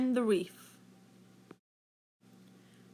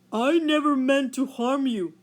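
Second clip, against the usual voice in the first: louder whale voice, other timbre; 2 semitones higher, 5 dB louder than usual. A narrator's reading. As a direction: speak as the big gentle whale. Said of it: "said the whale".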